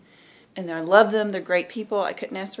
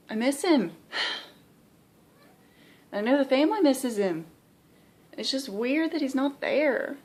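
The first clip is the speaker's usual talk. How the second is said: emotional; 6 semitones higher than usual. Real, not acted. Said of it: "Trying not to get emotional".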